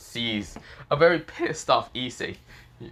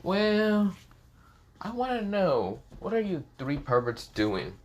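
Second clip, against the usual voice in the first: put-on high teasing girl voice; girly voice; 9 semitones higher, slower than usual.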